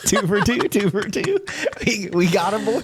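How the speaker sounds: laughing while gloating; giggling through the words. Real, not acted. Laughing at co-host getting caught.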